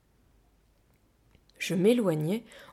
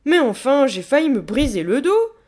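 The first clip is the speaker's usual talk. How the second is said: loud, raised, cross complaint; indignant protest; 6 semitones higher, 10 dB louder than usual. A narrator's reading. Displeased gorilla nearly broke her back, protesting indignantly. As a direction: scold the one who tricked you. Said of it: "La gorille croisa les bras mécontente".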